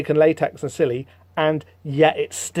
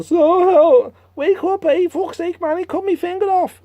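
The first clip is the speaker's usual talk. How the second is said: much higher, squeaky Cockney grumble; high whiny complaint; 13 semitones higher than usual. Acted. Jack complaining his finger was cut off, high squeaky whine. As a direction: whine it like a bloke missing a finger.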